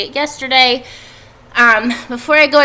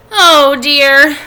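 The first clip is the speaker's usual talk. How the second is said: higher, louder fretful oh dear; worried sigh; 4 semitones higher, 10 dB louder than usual. Acted. Wilbur frets to himself, a higher and louder anxious cry. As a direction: Wilbur lets the worry burst out.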